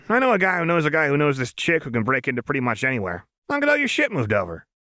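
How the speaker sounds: fast, high, run-on chatter; manic, can-do babble; Jinx, breezy. A narrator's reading. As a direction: rattle it off without a breath. Jinx rattles off plans.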